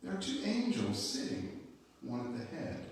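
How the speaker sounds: ordinary delivery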